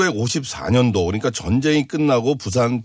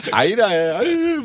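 playful Gyeongsang dialect imitation, higher pitched; mock Gyeongsang crying; 8 semitones higher than usual. Guessing how a Gyeongsang girl would cry in dialect, mock Gyeongsang. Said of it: "경상도 사투리로 울면 어떻게 우는 건가요".

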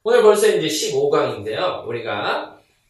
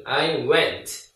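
slow clear model. Shown as a demonstration. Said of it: "나는 갔어 그러면".

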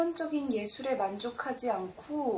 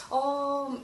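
normal talking voice

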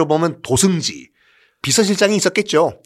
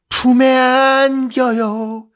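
높고 느리게 교태 부리듯; 애교 섞어 늘어지게 말하기; 간드러지게; 9 semitones higher, 4 dB louder, much slower than usual. Acted. 안기는 장면을 연기함. (높고 느린 교태 섞인 목소리)

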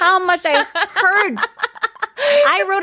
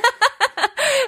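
giggle; 9 semitones higher than usual. Real, not acted. Laughing at the compliment.

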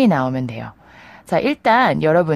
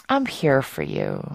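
softer, lower and slow, like comforting a friend; tender reassurance; 5 semitones lower, 5 dB quieter, much slower than usual. Shown as a demonstration. Teacher voicing comfort to a troubled friend, tender and soft. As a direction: say it gently, as if your friend is hurting.

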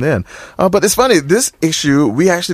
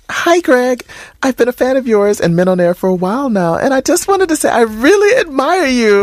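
lifted, higher woman's voice reading a fan letter; female voice; 12 semitones higher than usual. Acted. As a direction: read it as the young woman who wrote in. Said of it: "should I read it in the female's voice".

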